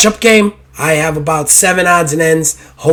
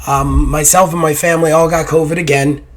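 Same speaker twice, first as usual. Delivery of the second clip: ordinary delivery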